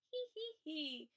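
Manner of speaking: storybook elephant starting to giggle; acted giggle. A narrator's reading. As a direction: titter it lightly. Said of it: "He began to giggle".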